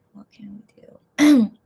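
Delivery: slow breathy whisper. Real, not acted. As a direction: whisper it back to yourself, slowly. Repeats line as slow whisper.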